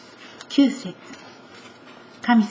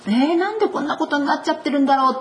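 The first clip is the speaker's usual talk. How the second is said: fretful complaint; 5 semitones higher, faster than usual. Pretend. Voicing troubled believer.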